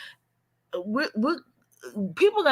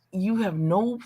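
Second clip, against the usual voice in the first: ordinary talk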